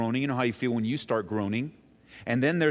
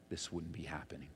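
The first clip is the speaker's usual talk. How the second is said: hushed, breathy aside of grief; whisper; 9 semitones lower, 17 dB quieter than usual. Retold or imitated. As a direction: breathe it out like a private regret. Sisters' grieving thought voiced aloud.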